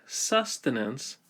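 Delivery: "sustenance" stretched out, stress on the first syllable; shortened vowel. Shown as a demonstration. Teacher shows emphasis pulling off the long vowel, shortened vowel. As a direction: stress SUS in "sustenance", reduce the middle vowel. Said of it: "the emphasis pulls off of the long vowel".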